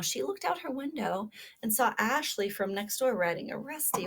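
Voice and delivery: lively storybook read-aloud; reading to children. Acted. Teacher reading a kindness story to children, animated. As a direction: read it like a picture book to little listeners.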